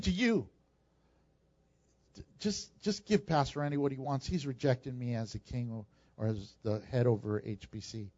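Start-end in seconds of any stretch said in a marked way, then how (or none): 2.4-8.1 much softer and lower, heavy with grief; a hushed, hurt God handing someone over; sorrowful God's voice. Retold or imitated. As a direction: let it sink, quietly rejected. Imagined God speaking sadly.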